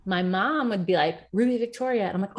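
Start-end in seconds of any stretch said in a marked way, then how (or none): 1.3-2.1 mom snapping out the full name, higher; a touch of a stern mom calling the first and middle name; mom's harsh tone. Retold or imitated. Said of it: "like, a harsh tone".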